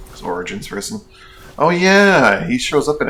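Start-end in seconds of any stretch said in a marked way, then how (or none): 1.6-3.1 high, loud "oh yeah" of remembering; real pleased recall; sudden realization. Real, not acted. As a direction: it suddenly comes back to you, pitch swooping up. Suddenly remembers the character shows up.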